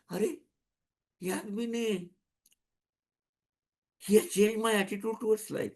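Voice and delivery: high, soft voice of amazement; imagine someone marvelling, hushed and high-pitched; softly voiced wonder; awed realization. Voicing someone amazed at life change.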